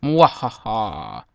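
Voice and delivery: slow low evil cackle; mock villain laugh, drawn out; mad scientist laugh. Mock evil laugh after scare.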